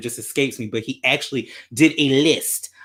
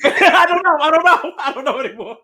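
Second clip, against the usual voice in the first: high, laughing helpless reply; 10 semitones higher than usual. Real, not acted. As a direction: laugh through the words. Laughing at own rant.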